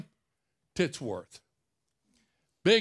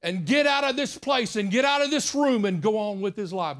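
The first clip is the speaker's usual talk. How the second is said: yelled prayer; 5 semitones higher, 4 dB louder than usual. Retold or imitated. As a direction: belt out the retold prayer, drawing out the words. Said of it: "he wasn't yelling like that".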